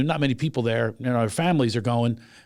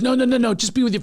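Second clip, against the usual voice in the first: higher, louder, rapid string of refusals; retold insistence; 10 semitones higher, 3 dB louder than usual. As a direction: insist politely, imitating someone waving off help. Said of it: "she kept insisting".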